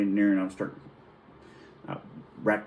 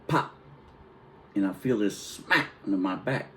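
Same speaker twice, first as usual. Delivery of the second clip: ordinary talk, nothing marked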